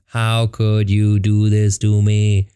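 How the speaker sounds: slow, heavy, each word stretched out; slow-motion magic words; drawn-out lament. Retold or imitated. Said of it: "The magic words are".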